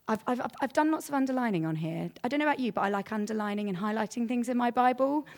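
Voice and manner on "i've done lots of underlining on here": ordinary delivery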